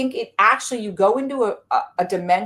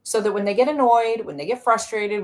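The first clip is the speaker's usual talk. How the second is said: ordinary talk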